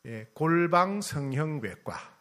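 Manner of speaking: 골방 성형외과 drawn out slowly, 골방 stressed; slow punchline. Shown as a demonstration. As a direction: land 골방 성형외과 slowly as the reveal. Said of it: "성형외과 하나 소개해 드릴게요".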